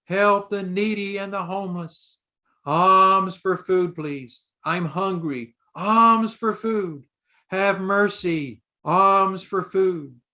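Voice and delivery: put-on high, slow pleading child beggar; boy begging buyers in the marketplace, high and drawn-out. A narrator's reading. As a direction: draw out each alms like a begging call. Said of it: "he begins his discourse by saying".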